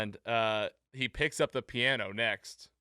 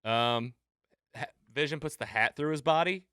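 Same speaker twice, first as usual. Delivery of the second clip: ordinary delivery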